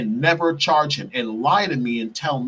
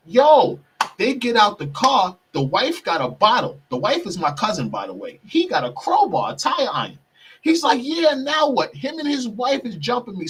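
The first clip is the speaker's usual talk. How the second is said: excited; 8 semitones higher than usual. Real, not acted. Attackers arrive with weapons.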